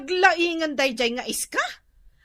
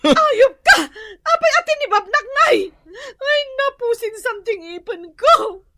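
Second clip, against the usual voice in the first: screaming insults; 5 semitones higher, 7 dB louder than usual. Acted. Wife screams during fight.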